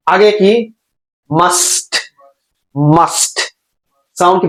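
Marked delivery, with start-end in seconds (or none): 1.3-3.4 MUST with held S and late released T; T sound after. Shown as a demonstration. Teacher drilling the ending, MUST with separate T. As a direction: hiss out the S of MUST, then tap the T. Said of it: "পরের টি সাউন্ডটা".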